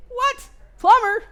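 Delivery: very high, loud retold disbelief; astonished. Teacher reacting to student's plumbing job, astonished. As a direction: exclaim it in high-pitched amazement.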